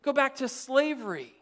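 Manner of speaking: mock whining Israelites; complaining. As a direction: whine at Moses like grumbling people. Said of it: "started complaining to Moses".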